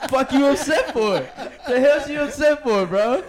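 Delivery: loud, high, laughing indignation; mock outrage. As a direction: shout it in jokey disbelief. Acting outraged at girlfriend's grief.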